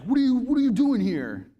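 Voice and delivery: high, loud bold shout. Shown as a demonstration. Demons shouting boldly at Jesus, loud and high. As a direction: shout boldly in his face. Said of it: "I don't think this is like".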